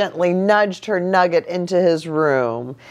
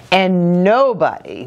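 ordinary delivery

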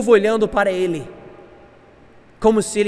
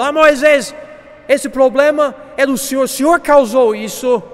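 high, loud mock grumbling of a crowd; loud complaining; 7 semitones higher, 6 dB louder than usual. The people blaming Moisés at the sea, complaining loudly. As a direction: wail the accusation at their leader. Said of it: "E o povo reclamando".

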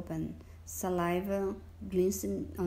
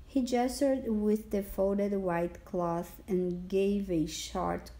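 usual speaking voice

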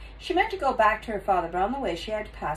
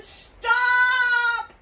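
long, high, loud yell; full-out yell; 5 dB louder than usual. A narrator's reading. Taya yells at the lady lifting her, full-out. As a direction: yell it as loud as you can. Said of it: "yelled as loud as she could".

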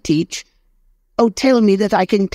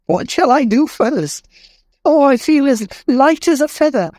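put-on much higher, giddy joyful old man; overjoyed Scrooge. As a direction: bubble over with joy like a new man. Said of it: "He danced".

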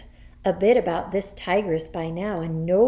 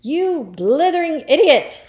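loud, high headmistress tirade; angry shout; 9 semitones higher, 9 dB louder than usual. Acted. Headmistress insults a pupil, shouting. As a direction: hurl the insult at full voice. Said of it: "shouted the Trunchbull".

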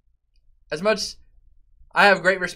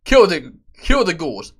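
ordinary talk

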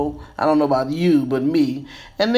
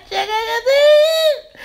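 excited squeal; slower than usual. Real, not acted. Showing off the finished bun.

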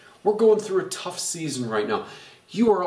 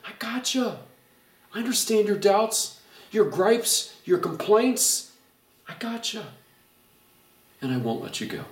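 reassuring; 7 semitones higher than usual. Pretend. God's voice, reassurance.

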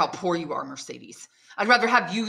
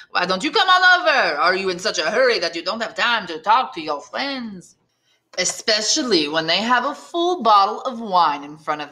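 voiced tavern men; 2 semitones higher, 4 dB louder than usual.

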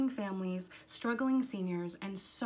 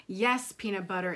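calm motherly confirmation; matter-of-fact answer; 2 semitones lower, 4 dB louder than usual. Acted. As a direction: answer evenly, no room for argument. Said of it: "answered her mom".

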